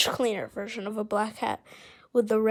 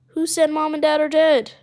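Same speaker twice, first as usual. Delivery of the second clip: higher and much louder, a frightened daughter's demand; alarmed shout; 6 semitones higher, 11 dB louder than usual. Acted. Beck shouting at the constable, alarmed and loud. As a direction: yell it, horrified at the news.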